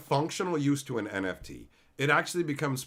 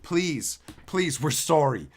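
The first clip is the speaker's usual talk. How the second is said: hammed-up pleading, building to a louder, slow wail; mock begging; 7 dB louder, slower than usual. Wizards begging the players to come back, mock wailing plea. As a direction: play the company grovelling, swelling louder at the end. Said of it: "they're just begging".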